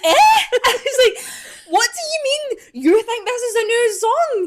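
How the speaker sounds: high, loud, laughing squeal of disbelief; howling with laughter at the stepdad's mistake. Retold or imitated. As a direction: shriek it through laughter, can't believe it.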